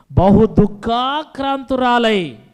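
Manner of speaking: slow, loud, drawn-out scripture reading; preaching scripture. Acted. Preacher reads the verse to his congregation, slow and loud.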